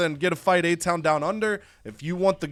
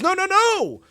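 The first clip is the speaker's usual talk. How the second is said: high, loud burst of protest; mock alarmed refusal; 6 dB louder than usual. Magician pushing a prying hand off his sleeves, acted loud protest. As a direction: cut them off with a high, hammed-up cry.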